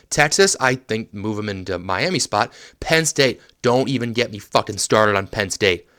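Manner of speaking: normal talking voice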